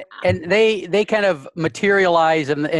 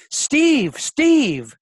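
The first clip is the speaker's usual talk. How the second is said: screaming; 9 semitones higher than usual. Retold or imitated. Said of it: "she's screaming".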